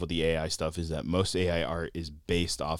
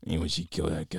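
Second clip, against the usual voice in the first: whispering; 2 semitones lower, 4 dB quieter, faster than usual. Acted. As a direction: breathe it like a menacing secret. Mock sinister whisper.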